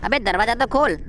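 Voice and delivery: ordinary talk, nothing marked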